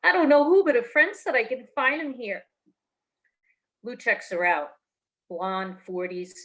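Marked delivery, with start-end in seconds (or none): 0.0-2.4 higher, breathless bar-floozy voice; ditzy drunk woman. Acted. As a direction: play her dim and lost. Tipsy customer asks for hitman.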